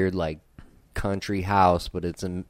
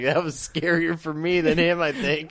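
high mock-scared voice; 10 semitones higher, 3 dB louder than usual. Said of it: "I was like".

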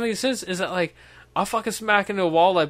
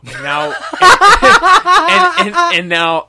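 laughing through it; 12 semitones higher, 9 dB louder, much slower than usual. Retold or imitated. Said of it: "She's like".

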